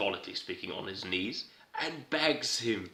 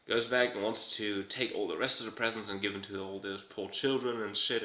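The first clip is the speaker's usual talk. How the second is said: usual speaking voice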